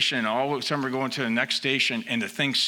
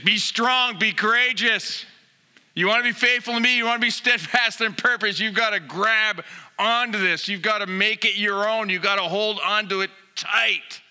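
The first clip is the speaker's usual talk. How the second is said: rally the church with rising fervor; full-force preaching; 7 semitones higher than usual. Acted.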